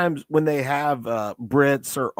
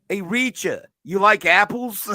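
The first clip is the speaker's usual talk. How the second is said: a touch higher Boston lilt; lightly voiced Boston character; mock Boston accent; 6 semitones higher than usual. Imagining the character teasing Reacher in a light mock Boston accent. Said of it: "with that accent. She's gonna be like".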